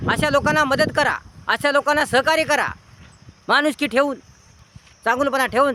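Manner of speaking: high-pitched, forceful sermon delivery; exhorting a congregation, voice lifted high; impassioned preaching. Real, not acted. Preaches with raised voice.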